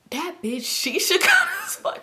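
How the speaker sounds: high, slow, cracking into laughter; laughing mimicry. Men in the car reacting to Shisha, high laughing mimicry. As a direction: squeal the quote through a laugh.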